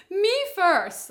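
loud, high mock demand; hammed-up selfish yell; mock shout. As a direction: shout it like a spoilt diva. Mock selfish wife in emergency.

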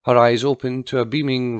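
usual speaking voice

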